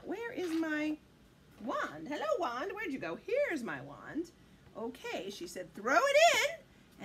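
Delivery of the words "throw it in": high, loud, drawn-out call; witch's command. A narrator's reading. Witch has everyone fill the cauldron, calling high. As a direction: call it out with storybook gusto.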